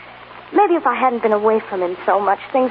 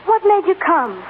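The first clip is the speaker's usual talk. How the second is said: usual speaking voice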